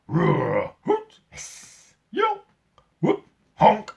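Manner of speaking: a run of wild zoo animal noises; roar, hoot and honk like woken animals; animal calls. A narrator's reading. Narrator mimics zoo animals woken by the siren, loud and high.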